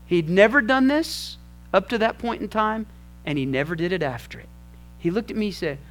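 ordinary delivery

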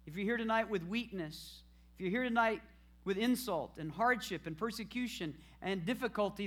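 ordinary delivery

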